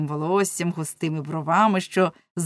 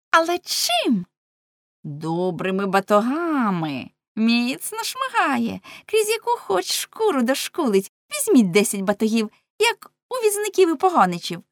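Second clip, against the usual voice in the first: put-on clamouring girls; 5 semitones higher, 4 dB louder than usual. A narrator's reading. Girls planning to whip Lamme, high clamour. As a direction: relish the threat in a raised voice. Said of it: "закричали дівчата".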